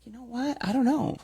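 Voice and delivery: high-pitched imitation of his answer; imitated companion reply. Travel companion answers her challenge, high-pitched imitated reply. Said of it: "And he's like".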